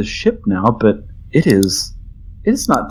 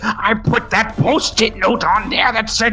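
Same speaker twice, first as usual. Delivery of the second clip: high, wailing furious captain; much higher, slow anguished howl; 10 semitones higher, slower than usual. Acted. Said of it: "The captain just howls".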